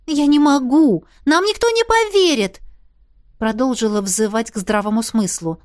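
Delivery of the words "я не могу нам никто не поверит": loud, high-pitched objection; exasperated protest. A narrator's reading. Heroine argues nobody will believe them, exasperated protest. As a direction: plead with rising pitch and force.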